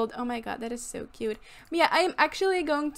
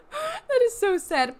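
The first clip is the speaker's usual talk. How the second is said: laughing pity; 9 semitones higher, 4 dB louder, slower than usual. Real, not acted. Sim skating alone.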